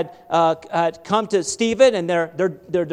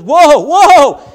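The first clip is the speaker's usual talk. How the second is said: loud, high desperate yell to a runaway horse; frantic cry to stop the horse; panicked whoa; 7 semitones higher, 11 dB louder than usual. Retold or imitated. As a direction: shout whoa like the horse is about to go over a cliff. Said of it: "and he says".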